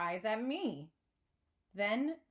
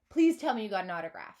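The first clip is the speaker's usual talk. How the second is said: louder, fast pleading; begging; 7 dB louder, faster than usual. A narrator's reading. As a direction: beg breathlessly.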